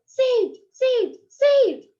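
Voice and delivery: put-on high, loud child's shout; screaming. A narrator's reading. Child on the bus screaming to save a seat. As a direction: yell it to claim the seat. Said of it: "you keep on screaming the word".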